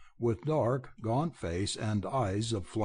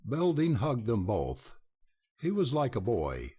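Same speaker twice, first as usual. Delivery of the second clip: usual speaking voice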